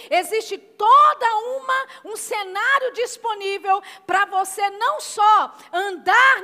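loud, driving preacher's proclamation; preaching at full force. Acted. Pastor to congregation, proclaiming their identity in Christ loudly.

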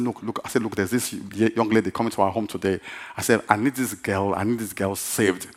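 soft, low-pitched retold scheming; hushed confiding. Preacher briefing his helper about the young lady, hushed confiding. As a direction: confide the plan under your breath.

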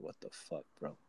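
hushed what the fuck, bro, breathed out; a stunned half-whisper; whispered shock. Retold or imitated. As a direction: barely voice it, stunned. Shock at seeing the photo.

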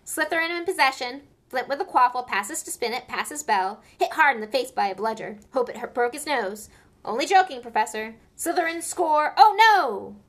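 loud, quick play-by-play; live commentary. A narrator's reading. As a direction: commentate the match excitedly.

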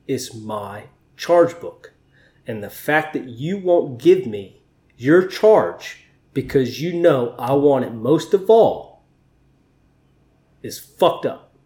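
slow, deliberate stress on charge; slow, defiant insistence. Retold or imitated. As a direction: spell it out slowly, holding ground. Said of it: "and I said".

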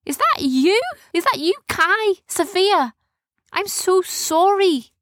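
high-pitched, rising disbelief turning to apology; astonished pirate girl. A narrator's reading. Captor recognising tied-up friends, astonished and sorry.

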